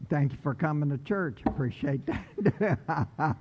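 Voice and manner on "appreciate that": laughing thanks. Real, not acted. Laughs after pastor's joke.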